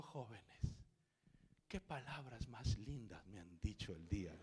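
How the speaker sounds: soft, low, moved voice; tearful woman, mild. Retold or imitated. Crying woman thanks the young men, softly imitated emotion. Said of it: "la mujer llorando abrió los ojos y dijo".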